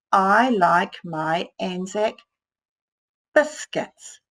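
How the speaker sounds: very slow, word by word; drawn-out reading aloud; slow sounding out. Shown as a demonstration. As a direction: read each word slowly while writing.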